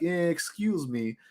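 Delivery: put-on slow, soft Russian-accented drawl. Retelling a stranger coming up behind him, Russian accent. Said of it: "in this Russian accent".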